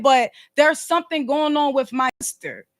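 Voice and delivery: ordinary talk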